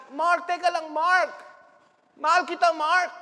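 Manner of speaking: a high, pleading call; pleading mother. Retold or imitated. Mother pleading with her son, high and loud. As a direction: beg him to stay.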